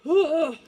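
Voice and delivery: loud exhausted ugh; winded groan. Real, not acted. Reader groans at her own breathlessness, loud ugh. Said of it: "why am I winded".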